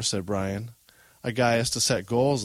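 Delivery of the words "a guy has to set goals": usual speaking voice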